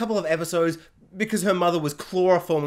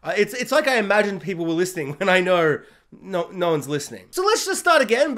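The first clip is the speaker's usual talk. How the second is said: usual speaking voice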